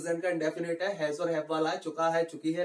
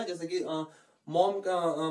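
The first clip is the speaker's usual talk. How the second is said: ordinary talk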